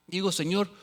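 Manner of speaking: normal talking voice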